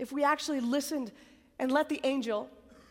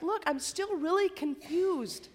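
confused plea; 6 semitones higher than usual. Retold or imitated. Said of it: "He's like".

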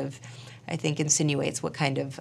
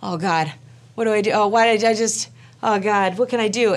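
higher, louder flustered husband; mild mock panic; 4 semitones higher, 5 dB louder than usual. Husband reacting to her crying, mildly panicked. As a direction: imitate someone at a loss, pitched up.

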